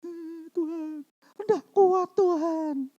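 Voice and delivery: high, drawn-out whimper to God; mock crying. Troubled believer who can't cope, mock tearful prayer. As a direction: imitate weeping prayer, slow and high. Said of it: "Tapi baru nangis".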